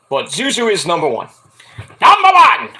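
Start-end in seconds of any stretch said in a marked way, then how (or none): 2.0-2.7 high, loud hammed-up roar; champion's shout. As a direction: bellow it like a wrestling boast. Cheering own cat as champion.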